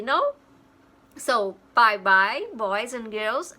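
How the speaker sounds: normal talking voice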